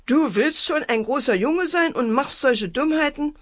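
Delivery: high, reproachful mother; annoyed scolding. A narrator's reading. Mother scolds son for his prank, high annoyed reproach. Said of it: "Mutter guckte ärgerlich".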